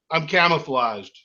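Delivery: usual speaking voice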